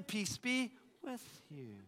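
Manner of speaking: slow, softened blessing; calming reassurance. Acted. Preacher voicing Jesus to freaked-out disciples, slow and calming. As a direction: settle them gently, taking your time.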